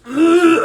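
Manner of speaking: high, loud strained noise; mock squeal of scrambling to adjust. Actor reliving on-the-spot adjustment, strained high squeal.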